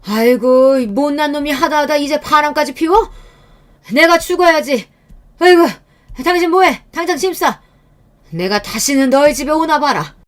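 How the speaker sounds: high, loud lamenting rage; an old parent howling in outrage; in-law furious at son's affair, high and loud wailing; furious wailing elder. A narrator's reading.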